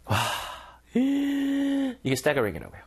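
gasp, then slow, high amazed words; awestruck. Shown as a demonstration. Teacher showing students the feeling of staggering. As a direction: drag it out in wonder. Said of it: "느낌 오세요?".

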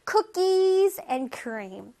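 cookies and cream slowly, drawing out KUH-keez; pulled-out cookies. Shown as a demonstration. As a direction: pull on cookies as you say cookies and cream. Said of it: "cookies 할 때 땡긴다고 생각해봐".

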